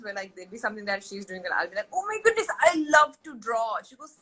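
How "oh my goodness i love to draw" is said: very high, loud playful gushing; hammed-up delight. Aunt joining niece's drawing, hammed-up delight. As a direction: gush in a high voice for a child.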